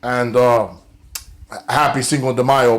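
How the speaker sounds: normal talking voice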